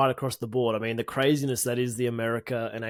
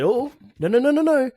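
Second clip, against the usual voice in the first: high, loud mock protest; mocking footballer voice; 11 semitones higher, 6 dB louder than usual. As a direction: squeal the no's like a spoiled player. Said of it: "bloody Sebastian Druisi said".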